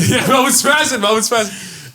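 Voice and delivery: high, giggly mock commentary; laughing echo. Storyteller agreeing with friend's teasing, laughing. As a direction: repeat the joke while cracking up.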